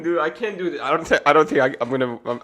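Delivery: usual speaking voice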